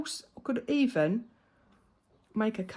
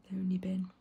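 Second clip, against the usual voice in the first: hushed jibe. Pretend. Mock self-scolding inner voice.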